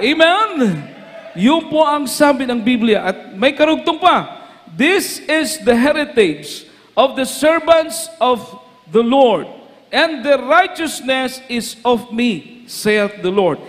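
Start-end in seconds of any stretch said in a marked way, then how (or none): 4.7-13.6 slow, solemn King James reading; scripture reading. Acted. Preacher reading the verse to the church, slow and solemn. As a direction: read the old English verse slowly.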